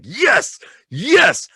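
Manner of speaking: high, drawn-out cheers; re-live a triumphant cheer; reenacted elation. Film fan reacting to the stitches reveal, triumphant cheering. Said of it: "And I was like".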